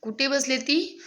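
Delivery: ordinary delivery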